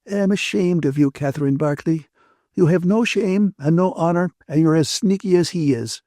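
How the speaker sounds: indignant Ferguson. A narrator's reading. Ferguson shames her friend Catherine Barclay, indignant. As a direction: shame her, voice lifted in reproach.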